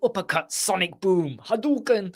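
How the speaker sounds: shouting out fighting-game special moves; video game move shouts. Pretend. To a friend on a call, mock fighting-game move calls. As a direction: bark the combo names like a game character.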